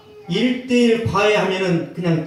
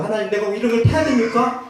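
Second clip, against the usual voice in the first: a touch of raised protest; complaining prayer; 6 semitones higher than usual. Retold or imitated. Soldier protesting to God about jumping, mildly raised. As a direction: whine at God, a little higher.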